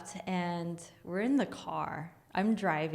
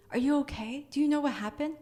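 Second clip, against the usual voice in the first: high, urgent questions; pitched-up re-enacted worry; anxious concern; 8 semitones higher, 4 dB louder, faster than usual. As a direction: check worriedly on a shaken friend. Anxiously checking on friend.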